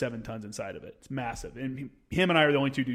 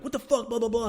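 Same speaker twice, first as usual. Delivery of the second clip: high, sketched-out telling-off; mock scolding; 9 semitones higher than usual. Gunny chewing out the kid, mock scolding. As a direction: imitate a superior's rant in a raised pitch.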